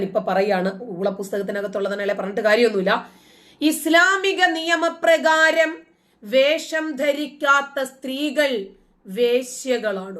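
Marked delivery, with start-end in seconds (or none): 3.6-10.2 slow, much higher and louder, each word hammered; slow indignant declaration; outraged emphasis. Retold or imitated. Outraged quoting of the cleric.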